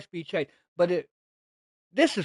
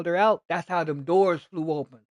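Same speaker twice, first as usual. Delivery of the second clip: ordinary talk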